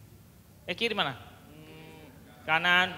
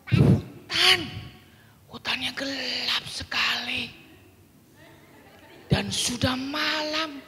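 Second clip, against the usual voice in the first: put-on higher, lively reading to children; expressive storytelling; 6 semitones higher than usual. Shown as a demonstration. Teacher telling children the lost bear story, lively. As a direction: tell it like a kindergarten story time.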